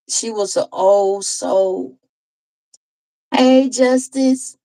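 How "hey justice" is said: higher, louder, drawn-out hello; calling out a greeting. Real, not acted. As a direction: call out slowly to a newcomer. Breaks off story to greet viewer.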